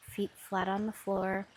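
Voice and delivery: ordinary delivery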